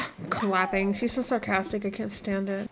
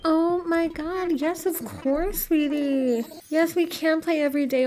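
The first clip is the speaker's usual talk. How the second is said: high, slow cooing; 5 semitones higher than usual. Real, not acted. To Dizzy the villager, high slow baby-talk cooing. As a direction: coo at a beloved pet.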